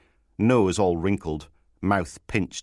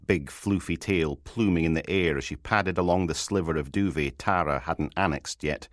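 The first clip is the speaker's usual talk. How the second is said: plain reading voice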